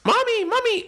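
mock high squeal; squeaky put-on cry of mommy; Cuban guy voice. Cuban volunteer hams it up for crowd, squealing high. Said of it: "Cuban guy starts going".

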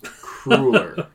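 amused, questioning Cruller said "CROO-ler"; Cruller as "crooler". Shown as a demonstration. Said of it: "I thought it was Cruller".

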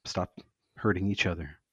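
ordinary talk, nothing marked

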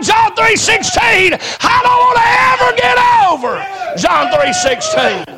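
high, shouted preacher's climax; preaching at full force. Acted. Preacher telling his congregation about John three sixteen, shouting. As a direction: cry it out to the church, stretching the key words.

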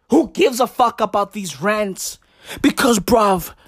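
normal talking voice

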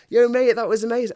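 loud, high, mock-cheering fan; excited fan. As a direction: imitate a hyped-up mate shouting praise. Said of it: "everyone's going".

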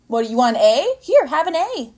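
loud, rising mock exasperation; exasperated offer. Fed-up teacher offering the mom a grade, exasperated. Acts teacher giving in to mother.

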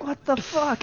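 high mock outrage; a hint of an offended girl; indignant friend. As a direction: imitate her stunned complaint. Dropped friend reacting.

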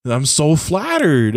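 hammed-up villain retort, stretched out at the end; Axel. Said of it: "And his response is".

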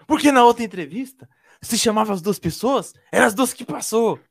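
high, loud mock despair; desperate. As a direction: wail it like a panicking candidate. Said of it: "de todo desesperado". (imitando alguém todo desesperado)

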